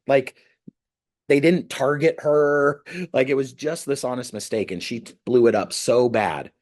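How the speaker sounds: usual speaking voice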